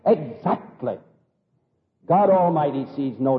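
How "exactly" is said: ordinary talk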